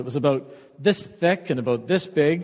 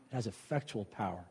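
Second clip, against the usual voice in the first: much softer, lower repeat; hushed echo; 7 semitones lower, 16 dB quieter than usual. Acted. Preacher echoing scripture's power to the congregation, hushed. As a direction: drop to a near hush to drive it home.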